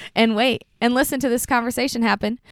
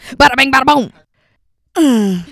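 slow, drawn-out flourish; showman flourish; 3 semitones higher, 5 dB louder, much slower than usual. Acted. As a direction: announce it like a showman. Flourish picking teams.

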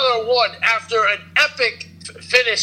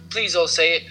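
ordinary talk, nothing marked

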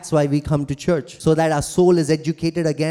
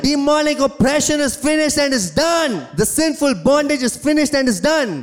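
building preacher cry, higher and louder; fervent preaching; 6 semitones higher, 3 dB louder than usual. Acted. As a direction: preach it to a climax, rising. Preaching climax, rising fervour.

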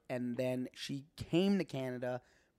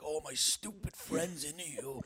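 slow, softer mock teasing; making fun; 6 dB quieter, slower than usual. Friend mocking him to Olivia, lightly imitated slow tease. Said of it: "went to make fun of me".

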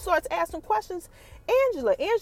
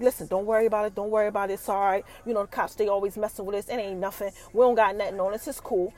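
calm reassurance; 6 semitones lower, 4 dB quieter than usual. Retold or imitated. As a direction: lower and softer, soothing. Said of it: "He's like".

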